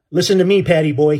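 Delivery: loud cutting-in shout. Acted. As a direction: shout over him to stop him. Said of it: "he shouted, interrupting me".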